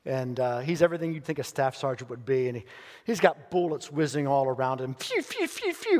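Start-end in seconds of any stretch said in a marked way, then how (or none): 4.9-6.0 bullet whizz sounds. Retold or imitated.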